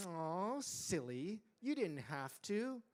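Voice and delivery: ordinary delivery, nothing marked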